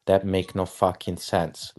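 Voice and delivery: ordinary talk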